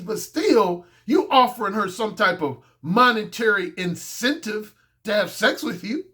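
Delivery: ordinary delivery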